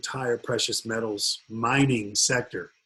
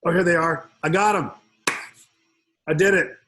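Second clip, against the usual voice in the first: high, loud triumphant cheer; delighted after fumbling with the software; excited relief; 5 semitones higher, 6 dB louder than usual. Real, not acted. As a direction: celebrate finally getting it working. Finally finds the questions.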